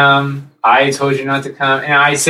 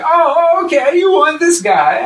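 weird, high-pitched comic voice; strained high squawk, imitating a comedian's act; Bobcat Goldthwait. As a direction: put on the weird stage voice, way up high. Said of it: "he does that weird voice thing he does".

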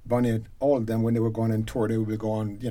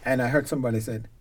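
normal talking voice

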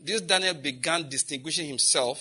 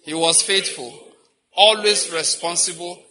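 call and response lead; 5 dB louder than usual. Shown as a demonstration. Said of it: "Go by it again".

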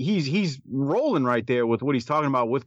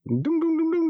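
high sung doom doom like an alert going off; imitated alarm sound. Cohost mimicking his ears perking up, high mock sting. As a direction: mimic a dramatic sting, pitched way up. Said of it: "Like, my ears went up, like".

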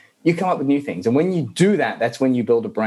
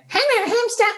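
squeaky, very high toy voice; tiny high-pitched playback voice; high-pitched toy hamster. Acted. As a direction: squeak it back like an annoying recording toy. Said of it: "plays it back really high-pitched".

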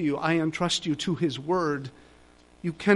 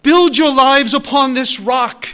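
loud, higher call to the congregation; ringing exhortation; 7 semitones higher, 12 dB louder, slower than usual. Acted. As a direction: proclaim it like a rallying cry. Climactic exhortation.

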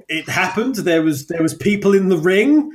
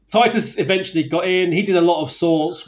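usual speaking voice

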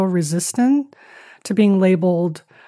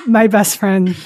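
laughing; 3 semitones higher, 6 dB louder than usual. Real, not acted. Joking about Google as best friend.

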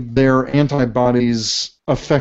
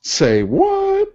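surprised. Real, not acted. Stunned reaction to odd science.

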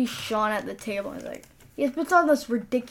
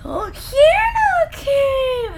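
squealed high, slow and loud with a laugh; excited little kid; 7 dB louder, much slower than usual. Retold or imitated. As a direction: squeal it like a thrilled child on Christmas morning.

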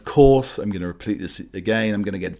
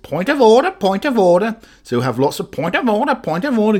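mock parrot shout; 12 semitones higher, 5 dB louder than usual. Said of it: "The parrot Boris will be shouting".

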